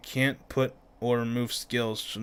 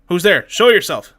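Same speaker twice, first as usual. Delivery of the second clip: loud, higher challenge; shouting; 6 semitones higher, 14 dB louder than usual. Acted. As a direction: call out to the hidden intruder. Character calls out at intruder.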